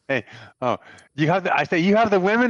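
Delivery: playing dumb. Player acting like a clueless Westerner facing the gunman, high rising loud question. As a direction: ham up a clueless tourist asking.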